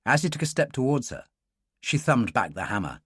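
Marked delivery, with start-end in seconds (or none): none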